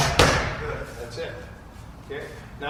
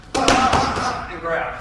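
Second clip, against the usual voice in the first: punch sounds; 7 semitones higher, 7 dB louder than usual. Shown as a demonstration. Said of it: "coach demos punches with sounds".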